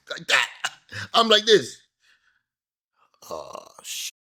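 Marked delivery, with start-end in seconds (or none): 3.3-4.1 whispered curse. Retold or imitated. Reaction to being snubbed again.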